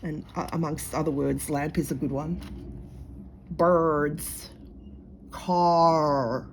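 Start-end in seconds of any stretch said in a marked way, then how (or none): 3.5-6.5 long American r in each word; exaggerated American accent. Poking fun at American friends, exaggerated American r. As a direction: drag out the r, American style. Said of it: "poking fun at the American accent".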